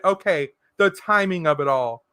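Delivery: ordinary talk, nothing marked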